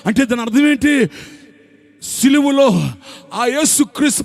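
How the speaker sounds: high, loud, fervent sermon climax; preaching at full force. Acted. Pastor to congregation, fervent loud preaching.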